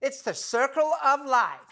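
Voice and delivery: high, perky put-on chirp; chirpy duchess imitation. Presenter mimics the duchess perking up after the lobster's death, chirpy. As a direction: perk right up in mocking imitation. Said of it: "the Duchess perked up and chirped".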